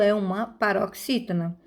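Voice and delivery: usual speaking voice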